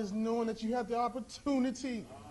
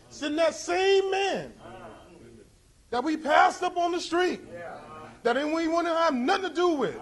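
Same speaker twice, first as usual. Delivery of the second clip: desperate begging; 4 semitones higher, slower than usual. Acted. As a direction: beg with all your strength. Rich man begging for Lazarus.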